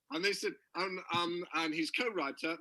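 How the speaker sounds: ordinary talk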